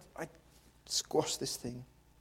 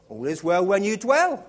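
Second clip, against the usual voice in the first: proclaimed slogan; 5 semitones higher, 6 dB louder than usual. Acted. Slogan again.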